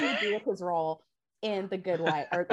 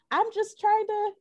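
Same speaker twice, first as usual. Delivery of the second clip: pastor voice; 12 semitones higher than usual. Retold or imitated. Mocking the pastor in pain.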